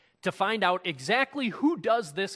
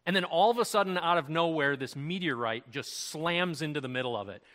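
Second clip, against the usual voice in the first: normal talking voice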